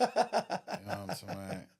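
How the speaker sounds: laughing through the words. Real, not acted. Laughing while talking.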